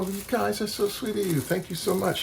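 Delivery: gushing thanks. Retold or imitated.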